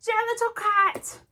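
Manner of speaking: high and loud amateur Cockney; a Cockney accent that barely holds; bad Cockney accent. Shown as a demonstration. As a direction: push a strained Cockney through "little". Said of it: "I can only hold this for a few seconds".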